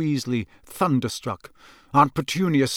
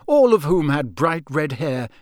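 ordinary delivery

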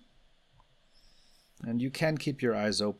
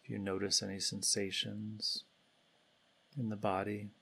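calm, unhurried guidance with long pauses between phrases; guided meditation; 4 dB quieter than usual. Real, not acted. Meditation leader guiding everybody to turn attention inward, calm and spacious. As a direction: lead the group gently inward, leaving space after each phrase.

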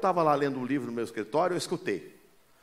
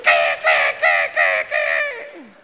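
ganido agudo de cachorrinho imitado; latido imitado; 11 semitones lower, 6 dB louder, much slower than usual. Retold or imitated. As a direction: imite um filhote latindo assustado. Cachorrinho latindo. (filhote ganindo, imitado pelo pregador)

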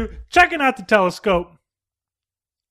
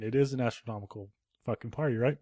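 ordinary talk

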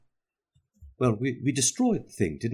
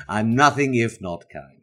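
usual speaking voice

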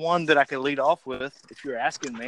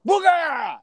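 a much higher, loud goofy grunted word; Barbarian voice; 13 semitones higher, 4 dB louder than usual. Retold or imitated. Imagining the Barbarian answering hello, goofy high shout. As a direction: bark it out like the wrestler. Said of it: "He'd be like".

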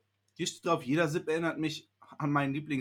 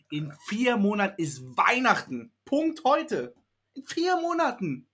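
excited disbelief; 5 semitones higher, 6 dB louder, slower than usual. Real, not acted. Shocked that Christmas is close.

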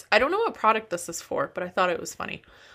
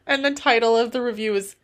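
ordinary talk, nothing marked

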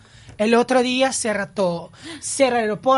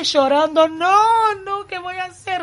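high, loud cry of despair; imitating his own desperate wail; mock despair; 7 semitones higher, 5 dB louder, slower than usual. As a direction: act out the panic, voice up. Retelling his despair, crying.

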